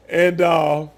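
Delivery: ordinary delivery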